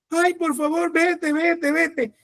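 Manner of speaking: high pleading whimper; begging whine. Shown as a demonstration. Preacher shows the wrong way, begging the demon in a high whine. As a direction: beg feebly, the wrong way. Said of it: "No es rogándole".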